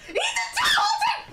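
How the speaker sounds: piercingly high, loud shriek; whistle-register scream. Retold or imitated. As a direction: scream the urging at the top of your range. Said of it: "hit a fucking whistle register when I screamed".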